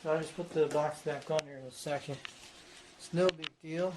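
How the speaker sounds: ordinary talk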